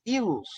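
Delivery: "ilus" dito como palavra nova, com i inicial; pronome neutro. Shown as a demonstration. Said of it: "Substituídos por pronomes não binários". ("ilus" pronunciado como pronome neutro)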